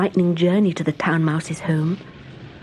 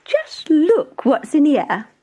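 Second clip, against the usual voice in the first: showing off; 8 semitones higher, 4 dB louder, slower than usual. Acted. Town Mouse showing off.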